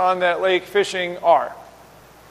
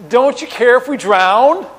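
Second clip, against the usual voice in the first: pointed, louder sarcastic question rising at the end; sarcastic jab, pitched up, voiced loudly; 4 semitones higher, 6 dB louder than usual. Disciples waking Jesus in the storm, sarcastic. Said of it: "you note the sarcasm in there".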